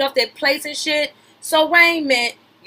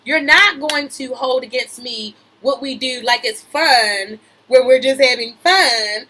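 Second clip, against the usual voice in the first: ordinary talk, nothing marked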